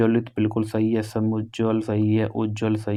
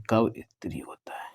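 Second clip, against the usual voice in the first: कब इत्री होता है whispered with no voice; whispered repeat; 5 dB quieter than usual. Shown as a demonstration. Teacher quietly whispering the correct spelling to students. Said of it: "कवित्री नहीं".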